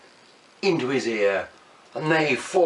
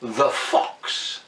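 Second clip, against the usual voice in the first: ordinary delivery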